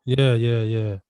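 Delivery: usual speaking voice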